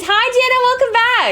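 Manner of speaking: sing-song greeting. Real, not acted. Drawn-out greeting.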